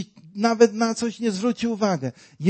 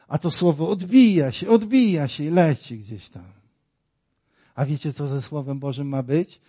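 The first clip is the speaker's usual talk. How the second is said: ordinary delivery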